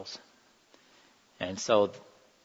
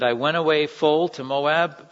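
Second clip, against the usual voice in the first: higher, louder, aggrieved outcry; bitter lament; 5 semitones higher, 8 dB louder than usual. Acted. Naomi tells the neighbors of her loss, raised and bitter. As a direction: cry it out like a wronged widow.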